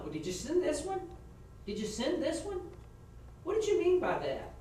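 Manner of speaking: rising, pressing questions to a witness; mock cross-examination. Shown as a demonstration. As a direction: push her on each message, pitch climbing. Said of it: "I'd have gone down with each one".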